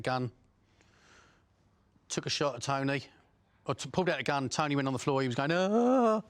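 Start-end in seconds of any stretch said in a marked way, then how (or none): 5.5-6.2 high, loud wail imitating a whimper; mock girlish scream. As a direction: imitate a man screaming like a little girl. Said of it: "literally screaming like a little girl".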